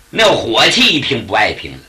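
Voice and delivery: ordinary delivery, nothing marked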